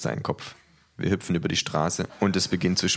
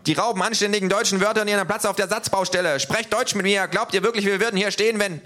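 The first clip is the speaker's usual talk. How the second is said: high, a bit louder mock yell; yelling neo-Nazi; 3 dB louder than usual. A narrator's reading. Neo-Nazi yells at the foreign words, mild mock shout. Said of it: "schreit er".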